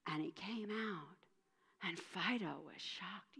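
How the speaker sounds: whispered "And Fido was shocked."; hushed narration. A narrator's reading. Narrator building suspense for listeners, whispering. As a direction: breathe it out softly.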